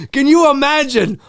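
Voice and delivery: ordinary delivery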